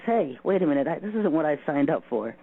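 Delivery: ordinary talk, nothing marked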